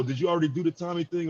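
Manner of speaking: ordinary talk, nothing marked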